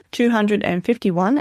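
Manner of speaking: usual speaking voice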